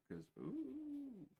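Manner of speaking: sudden high falsetto squeal. Real, not acted. Squealing as the thought hits her.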